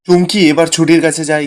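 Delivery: plain reading voice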